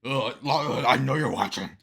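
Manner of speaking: slow, groaning mock of a heavy man; mock Christie. Reactor mocks candidate calling out Trump, slow laboured groan. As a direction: heave the words out slowly with a grunt. Mocking Christie's line just played.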